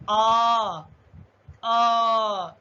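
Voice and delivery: आ drawn out long and called loudly; long vowel sound. Shown as a demonstration. Teacher demonstrating vowels to pupils, loud and drawn out. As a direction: call out आ loud and long, twice. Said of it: "जैसे हम पढ़ेंगे".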